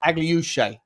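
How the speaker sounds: Agliouche said the French way, stress on the last syllable "shay"; French pronunciation. Shown as a demonstration. Reading out the player's name as French commentary says it. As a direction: give Agliouche its proper French sounds. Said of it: "pronounced his name correctly".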